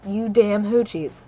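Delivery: normal talking voice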